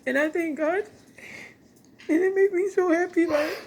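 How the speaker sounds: choked up and crying while thanking God; real tears, high and strained. Real, not acted. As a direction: voice breaking into high tearful pitch. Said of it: "I don't wanna cry like I'm doing now".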